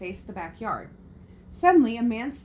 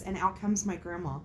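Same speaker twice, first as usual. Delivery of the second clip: usual speaking voice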